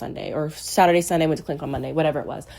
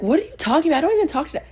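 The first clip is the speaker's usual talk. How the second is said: very high-pitched protest, retold; indignant denial; 10 semitones higher, much faster than usual. Sister denying she told dad, indignant. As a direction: imitate a baffled sister, voice way up.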